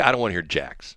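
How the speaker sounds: ordinary delivery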